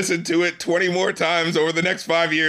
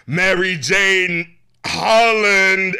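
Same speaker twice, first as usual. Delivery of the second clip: slow, drawn-out, louder imitation of [name]; imitating [name]'s delivery; 5 dB louder, much slower than usual. Host mimics how [name] sang the line, drawn out. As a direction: stretch each word like her odd lyric. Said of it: "The way she said it, it was like".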